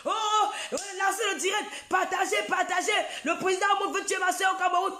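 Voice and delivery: hammed-up panicked broadcast; mock frantic shouting. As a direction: scream for everyone to share the live. Imitating her shouting live on Facebook.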